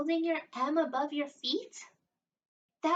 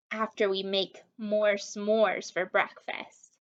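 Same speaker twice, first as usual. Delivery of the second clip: ordinary talk, nothing marked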